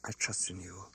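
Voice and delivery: soft, faint, hardly getting words out; a small quiet reply, choked with feeling; barely able to answer. Acted. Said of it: "She could scarcely answer".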